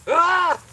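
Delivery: sudden high yelp of fright; startled yelp. Real, not acted. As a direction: yelp as something jumps out. Another scare in the garden.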